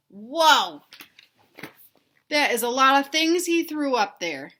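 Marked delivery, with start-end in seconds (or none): 0.1-0.8 loud playful whoa; mock surprise. Teacher reacting to the story for children, loud playful whoa. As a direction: exaggerate the shock for the kids.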